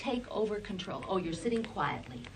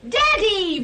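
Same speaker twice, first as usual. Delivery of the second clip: much higher and louder, thrilled; delighted praise; 11 semitones higher, 11 dB louder than usual. Real, not acted. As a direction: burst out with joy at the word. Child just said Daddy.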